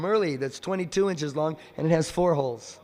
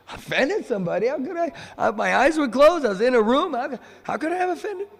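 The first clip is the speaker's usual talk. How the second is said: high-pitched, baffled self-defence; incredulous protest; 8 semitones higher, 3 dB louder than usual. Retold or imitated. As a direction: act out disbelief, pitch leaping on the questions. Acted bewildered protest at accusation.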